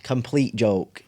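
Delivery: slow, drawn-out repeat; dad's slow joke. Retold or imitated. Dad teasing his daughter, slow drawn-out words. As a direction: stretch each word out like a punchline. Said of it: "And then he goes".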